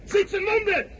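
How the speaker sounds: high, ringing cry to the troops; rousing cry. Acted. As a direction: show the wounds to stir them up. President rousing the soldiers.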